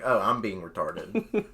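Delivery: low, soft, chastened realization; inner self-talk. Retold or imitated. Calming himself in his own head, low resigned self-talk. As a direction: drop low and quiet, talking yourself down. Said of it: "I resolved it in my own head".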